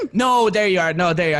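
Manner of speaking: ordinary delivery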